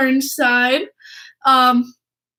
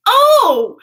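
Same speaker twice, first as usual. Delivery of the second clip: sudden high loud oh of realization; surprised oh; 9 semitones higher, 6 dB louder than usual. Retold or imitated. Clutterbuck getting the handshake, sudden loud oh. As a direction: blurt it out like it just clicked. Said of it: "And he goes".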